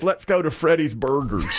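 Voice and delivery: a touch of mock excitement, then laughter; amused retelling breaking into a laugh; laughing at the irony. Mocking wife's upscale choice.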